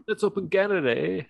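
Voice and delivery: high-pitched mock Canadian "eh"; squeaky Canadian parody; mock Canadian accent. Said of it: "he says, like, Canadian things".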